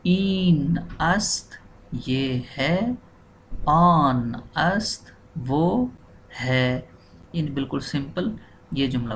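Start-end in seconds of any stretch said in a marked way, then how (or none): 0.1-3.0 इन अस्त drawn out slowly. Shown as a demonstration. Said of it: "क्या बनेगा". 3.7-6.9 आन अस्त वो drawn out slowly. Shown as a demonstration. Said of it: "क्या बनेगा".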